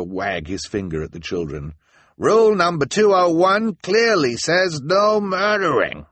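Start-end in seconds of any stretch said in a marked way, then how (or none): 2.2-6.1 high, loud, slow pronouncement; indignant scolding. A narrator's reading. Mister Lesko scolds the children with a rule, indignant shout. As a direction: proclaim the rule accusingly.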